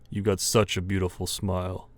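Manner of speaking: low, menacing purr; sinister villain voice. Acted. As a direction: tempt her like a creepy anime villain.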